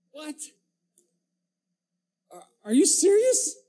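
can't believe what you just heard; incredulous. Acted. Preacher reacting to Christians who hate people, high incredulous.

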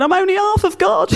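hammed-up high complaint; mock lament. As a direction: wail it like a silly complaint. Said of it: "walking around going".

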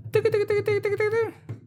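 very high clicking keyboard sounds; typing noise. Retold or imitated. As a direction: tap out the typing with your voice. Imitating officer typing on keyboard.

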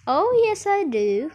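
high, loud opening that drops; gleeful confirmation. A narrator's reading. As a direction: confirm it with relish. Wonka gleefully confirms his plan.

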